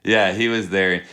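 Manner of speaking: ordinary delivery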